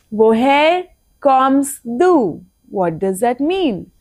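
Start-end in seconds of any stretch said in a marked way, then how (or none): 0.1-2.4 very slow, drawn-out repeat. Shown as a demonstration. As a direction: stretch every word for the class.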